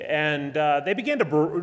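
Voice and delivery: normal talking voice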